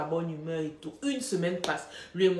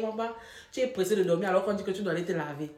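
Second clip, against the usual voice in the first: ordinary talk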